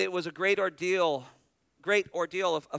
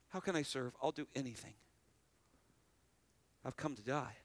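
much softer, humble and willing; soft humble offer; 3 semitones lower, 11 dB quieter, faster than usual. Retold or imitated. Sweet people quietly telling the pastor they will serve. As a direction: offer yourself gently.

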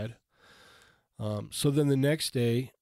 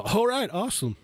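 higher, enthusiastic quoted reply; upbeat clerk; 9 semitones higher than usual. Retold or imitated. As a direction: act out a delighted store clerk.

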